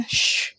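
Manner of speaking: unvoiced hushing sound; soft shush. Mother hushing her crying son, soft shush. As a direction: hush him quietly. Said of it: "लैनशांग कहती है".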